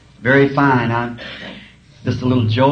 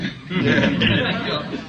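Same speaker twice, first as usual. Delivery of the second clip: breathy laugh; 3 semitones higher, 3 dB quieter than usual. Real, not acted. Laughs at punchline.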